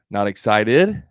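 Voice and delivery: "excited" swung up at the end; rising inflection. Shown as a demonstration. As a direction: ask "Not excited" with a clear lift on the last word. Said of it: "That's the inflection".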